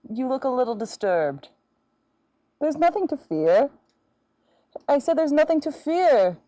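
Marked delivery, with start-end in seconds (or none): 2.6-6.4 loud, higher, hammed-up comfort; mock reassurance. Dressed as the fish girl to calm her frightened cat, booming louder and higher in hammed-up comfort. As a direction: boom it out, mock soothing.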